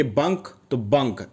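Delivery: बंक with a short 'uh', like 'bunk'; no 'ai' sound in बंक; spelling pronunciation. Shown as a demonstration. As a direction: read बंक letter by letter as written. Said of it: "ये लोग जो चीज़ है बी ए एन के".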